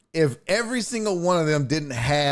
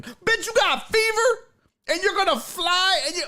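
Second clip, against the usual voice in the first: much higher, incredulous rant; outraged disbelief. Real, not acted. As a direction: squeal your disbelief at her nerve. Outraged rant about the sick woman.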